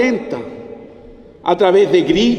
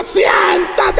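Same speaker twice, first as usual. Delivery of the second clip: father shouting; 9 semitones higher than usual. Retold or imitated. Father finally shouts at the son who won't sit. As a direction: bark the order like a fed-up father. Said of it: "el papá le grita".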